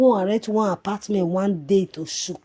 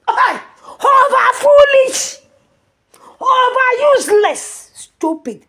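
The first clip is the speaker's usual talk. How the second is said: shrieking insults, very high and loud, dragged out slowly; furious shout; 10 dB louder, slower than usual. Real, not acted. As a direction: scream the insults right at her. Ranting insults at a woman.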